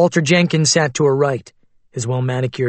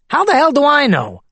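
much higher, louder exasperated snap; angry retort; 13 semitones higher, 4 dB louder than usual. Acted. Witness snapping at the questioning lawyer, angry retort. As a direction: throw the question back at her.

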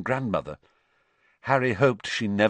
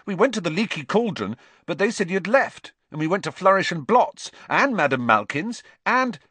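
eager babble; 10 semitones higher, 3 dB louder than usual. A narrator's reading. Ron babbling at Harry.

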